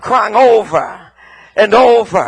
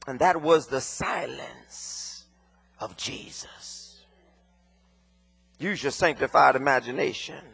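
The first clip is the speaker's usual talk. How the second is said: hushed sermon; 6 semitones lower, 7 dB quieter than usual. Acted. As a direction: pull the room in close. Sermon drops to hush.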